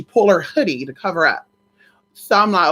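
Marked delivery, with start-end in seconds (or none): none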